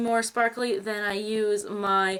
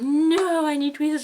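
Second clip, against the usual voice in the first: exasperated outburst; 5 semitones higher, 5 dB louder than usual. Retold or imitated. Retold panic over unibrow hairs.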